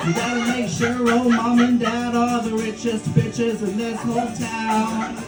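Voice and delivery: normal talking voice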